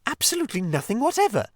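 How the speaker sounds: matter-of-fact. Acted. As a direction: Mole menjawab polos dan cepat. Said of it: "with perfect truthfulness".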